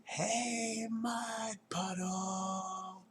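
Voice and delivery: high, slow call across the yard; yelling. Acted. Girl in a raincoat calls out to the mud puddle, drawn out and high. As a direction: stretch it out, calling the puddle. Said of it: "she yelled".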